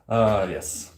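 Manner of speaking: ordinary talk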